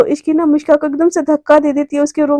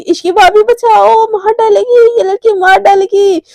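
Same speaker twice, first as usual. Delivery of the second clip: high, louder dramatic wail; cry for help; 6 semitones higher, 4 dB louder than usual. Acted. Romi telling Ishki the girl will kill her, wailing. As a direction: call out for rescue with mock panic.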